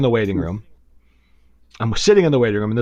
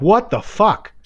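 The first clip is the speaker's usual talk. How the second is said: retold disbelief; 8 semitones higher, much slower than usual. Client retelling his reaction to the psychic, incredulous. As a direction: replay being thrown by it.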